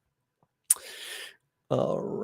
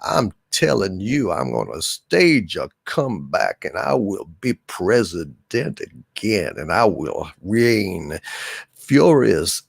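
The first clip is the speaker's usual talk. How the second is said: Kevin Spacey; 5 semitones lower than usual. Acted. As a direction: play a low-pitched, scheming House of Cards monologue. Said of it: "This is my impression of Kevin Spacey".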